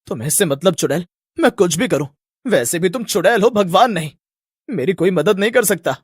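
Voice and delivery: irritated lad brushing the witch off; mildly angry retort, in a put-on boy's voice; angry young man. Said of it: "राज को यूं गुस्से में देख".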